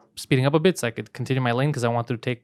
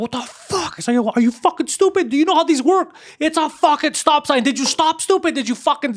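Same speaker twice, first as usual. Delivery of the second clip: reenacted road rage rant; 11 semitones higher than usual. Out of the car, berating the driver who ran the stop sign, furious high rant. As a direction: lay into him like he nearly hit you. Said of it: "just going crazy".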